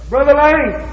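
high, drawn-out plea for Brother Lane; mock pleading caller. Imitating a caller pleading with Brother Lane. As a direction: call his name up high, begging. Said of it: "I spend an hour saying nothing but".

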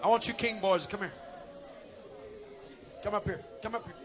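quiet. Real, not acted. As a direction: soften right down to a near hush. Quietly calling people forward.

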